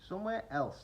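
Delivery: curt high snap; snapped. A narrator's reading. Duck snapping at Kingfisher, curt. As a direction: bite it off. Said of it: "snapped Duck".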